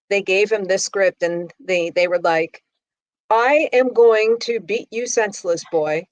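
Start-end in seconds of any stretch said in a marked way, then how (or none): 3.3-6.1 Harvard actor failing to sound gangster, in King's English; imitated formal King's English diction; mock King's English. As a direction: deliver a threat in over-proper King's English. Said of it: "the King's English and stuff".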